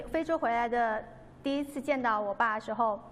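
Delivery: ordinary delivery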